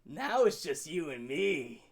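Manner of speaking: low, slow villain voice; mock menace. Cornering his girlfriend in the cage, mock menacing. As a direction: play the creepy horror villain.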